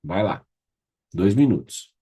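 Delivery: ordinary delivery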